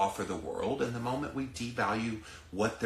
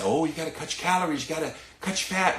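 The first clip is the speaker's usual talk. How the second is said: mocking officials; 6 semitones higher, faster than usual. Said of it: "now they're gonna say".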